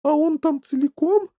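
high, loud anxious mother; worried. A narrator's reading. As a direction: ask in rising alarm. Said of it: "забеспокоилась миссис Ти Ви".

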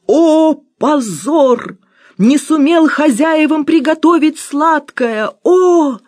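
high, loud, slow lament; tragic wailing. A narrator's reading. Cook lamenting his failed dessert, high and loud. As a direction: wail like a Greek tragedy chorus. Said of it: "заломил руки и заголосил".

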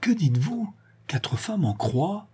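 high-pitched cry of disbelief; horrified, drawn-out question; shocked exclamation. A narrator's reading. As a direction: repeat it aghast, pitch leaping up. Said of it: "s'écria la Bretonne".

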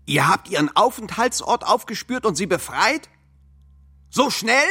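loud, rising disbelief building to a shout; can't believe they pulled it off so fast; incredulous outburst. Acted. Comrade confronting the others, loud incredulous outburst. Comrade stunned by quick rescue.

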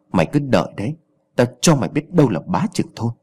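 ordinary delivery, nothing marked

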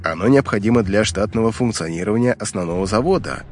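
ordinary delivery, nothing marked

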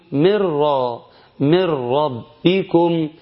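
no N in "من", only a held, doubled R. Shown as a demonstration. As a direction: chant "من را، من ربكم" slowly as "mir-raa, mir-rabbikum". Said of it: "وبعدين راء مشددة، ونقول". (ترتيل "من ربكم" براء مشددة بلا نون)

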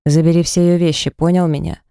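normal talking voice

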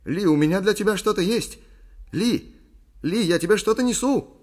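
loud, high calls across a beach; excited calling. A narrator's reading. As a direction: shout the good news from afar. Calls to her while running. (радостный крик издали)

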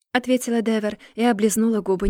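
usual speaking voice

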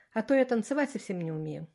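ordinary delivery, nothing marked

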